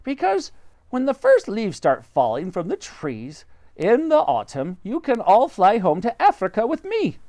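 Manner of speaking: high bird voice; roly-poly bird. Acted. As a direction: chirp it like a friendly bird.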